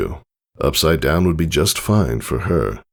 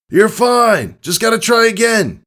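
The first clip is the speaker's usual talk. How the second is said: much higher, louder yell; 4 dB louder than usual. Acted. Father yelling to daughter over thunder. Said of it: "he yelled over a peal of thunder".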